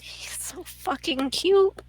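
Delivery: high, slow whisper; hushed gushing. Real, not acted. Player adoring the cat Oliver, hushed gushing. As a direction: whisper it in a high coo, stretched out.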